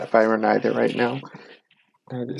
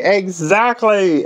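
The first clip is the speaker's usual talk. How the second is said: warm welcome; 8 semitones higher, much slower than usual. Acted. The beings warmly accept.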